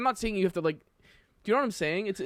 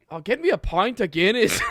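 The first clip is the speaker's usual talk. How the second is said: loud, higher mock Irish accent; Irishman; 5 semitones higher, 8 dB louder than usual. American friend imitating an Irishman, exaggerated Irish accent. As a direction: put on a hammy Irish brogue, half laughing. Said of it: "Irishman being like".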